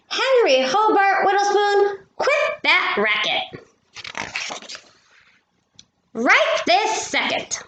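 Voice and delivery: a hint of a mother calling upstairs; mother yelling. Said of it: "Missus Whittlespoon yells".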